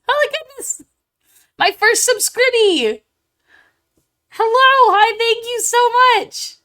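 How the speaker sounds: very high, loud squealing thanks; overjoyed. Real, not acted. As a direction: gush with real, giddy delight. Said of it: "I'm so happy".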